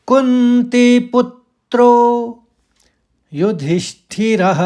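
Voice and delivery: कुंती पुत्रो slow, पुत then त्रो; stressed syllables. Shown as a demonstration. Teacher demonstrates the second quarter's stresses. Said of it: "दूसरे चरण को सुनिए।".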